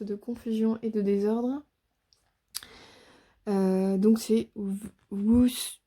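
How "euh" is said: normal talking voice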